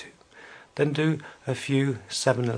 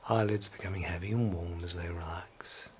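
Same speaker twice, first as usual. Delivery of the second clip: whispering; 4 semitones lower, 4 dB quieter than usual. Acted. Relaxation induction, whispered.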